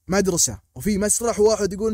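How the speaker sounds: normal talking voice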